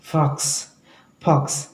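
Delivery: फॉक्स begun with an aspirated p, like "phoks"; lips-closed F. Shown as a demonstration. Teacher shows how learners misread it, p-like फ in फॉक्स. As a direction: pop both lips at the start of फॉक्स. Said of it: "इसलिए पढ़ते हैं".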